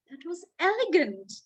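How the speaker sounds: ordinary delivery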